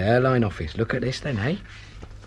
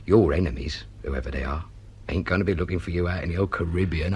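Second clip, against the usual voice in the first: normal talking voice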